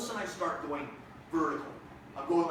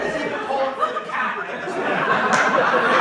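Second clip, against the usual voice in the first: normal talking voice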